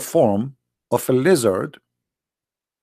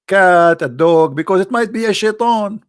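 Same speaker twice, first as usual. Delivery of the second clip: ordinary delivery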